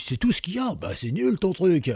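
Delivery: high-pitched, hammed-up disappointment; scornful mock gunman. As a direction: play an unimpressed robber, breaking into a laugh. Disappointed gunman finds decoy files.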